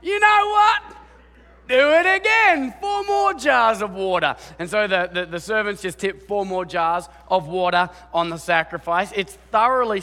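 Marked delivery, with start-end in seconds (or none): 0.0-4.3 high, loud shouted command; cheeky shout. Retold or imitated. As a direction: bellow the order like a cocky prophet. Elijah ordering more water.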